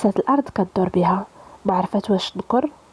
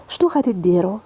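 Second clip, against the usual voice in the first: ordinary delivery, nothing marked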